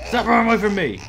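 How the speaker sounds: yelling after a fleeing enemy; loud and frustrated; frustrated shout. Real, not acted.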